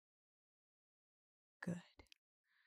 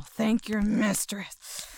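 "Thank your mistress" slow and louder, voiced; loud command; 3 semitones higher, 7 dB louder, slower than usual. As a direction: demand it out loud.

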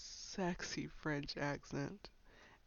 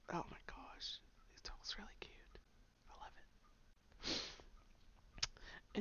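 soft, breathy swoon; hushed gushing; 3 semitones higher, 9 dB quieter, faster than usual. Real, not acted. Fan recalling a friend's cute talk, whispered gushing. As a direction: gush almost under the breath.

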